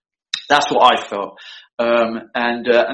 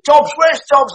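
higher, louder call for Dobbs across the set; retold shout; 11 semitones higher, 6 dB louder, slower than usual. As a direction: bark out the name like an angry director. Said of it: "and Jim said".

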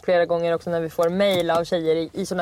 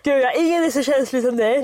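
mock belittling boyfriend; 6 semitones higher than usual. Listener mimics the boyfriend's belittling line.

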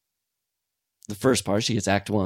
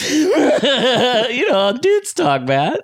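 very high, laughing squeal; laughing squeak; 14 semitones higher, 3 dB louder, much slower than usual. Pretend.

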